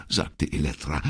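ordinary delivery

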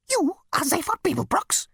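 high startled squeak; squeaking insect. Acted. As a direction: squeak in disbelief. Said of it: "it squeaked".

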